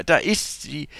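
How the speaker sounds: lightly scoffing, pitched a little up; mocking. A narrator's reading. Father retakes his recalled scoff at daughter, mildly mocking. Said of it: "spottete ich".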